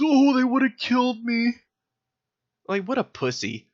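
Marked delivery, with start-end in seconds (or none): 0.0-1.5 high, far-off mock whimper of a coward; mocking whiny quote. Mocking the guy who got punched, high whiny wimp voice. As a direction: squeak it out like a scared wimp.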